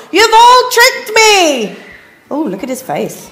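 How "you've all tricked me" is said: high, loud, drawn-out wail; howling. A narrator's reading. Poisoned Dingo accusing the animals, howling. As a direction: howl the accusation slowly. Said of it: "he howled".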